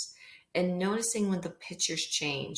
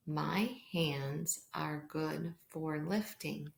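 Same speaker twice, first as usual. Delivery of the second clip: slow, soft read-aloud; reading to a toddler; 2 semitones lower, 6 dB quieter, much slower than usual. Acted. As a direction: read it slowly and gently to little kids. Reads pattern book aloud.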